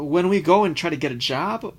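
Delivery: ordinary talk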